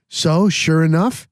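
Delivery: usual speaking voice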